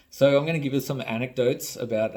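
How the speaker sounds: usual speaking voice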